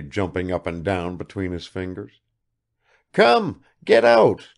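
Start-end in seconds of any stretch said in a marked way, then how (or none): 3.1-3.6 much higher, loud glad cry; overjoyed father; excited call. Acted. Father greeting son. 3.8-4.5 much higher and louder, OUT stressed; overjoyed father calling his son; eager urging. Acted.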